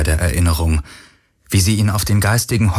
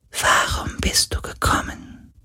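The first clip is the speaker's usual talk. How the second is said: ghostly whisper; 6 dB quieter than usual. Acted.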